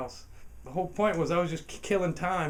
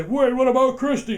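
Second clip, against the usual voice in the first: alarmed lady voice; 9 semitones higher, 12 dB louder than usual. Retold or imitated. Hotline lady pleading.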